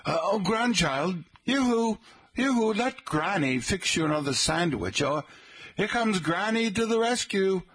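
put-on high, doting granny with a British accent; genteel British granny. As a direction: coo at the grandchild like a posh British granny. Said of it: "I can picture this woman talking to her grandchild".

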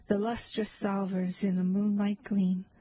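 ordinary delivery

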